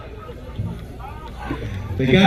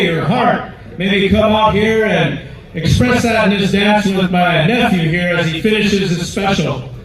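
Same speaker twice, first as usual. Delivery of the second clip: raised announcer voice to the arena; powwow emcee; 5 semitones higher than usual. Acted. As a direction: invite the whole crowd to dance. Emcee inviting dancers to the song.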